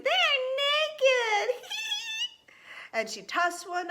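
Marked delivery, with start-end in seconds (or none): 0.0-1.6 very high, loud, gleeful squeal; giggly little girl. A narrator's reading. Kitten girl jokes about noodles, high giggly squeal. 1.7-2.3 very high kitten giggle; squeaky acted tee-hee. A narrator's reading. Kitten girl laughs at her joke, high giggle.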